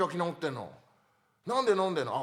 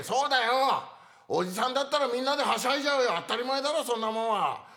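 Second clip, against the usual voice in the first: louder, hammed-up insistence; 5 semitones higher, 4 dB louder than usual. Comic insists loudly to partner, bar staring: loud. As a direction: defend the drunk-uncle logic at full voice. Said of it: "おい、大きい声出すな".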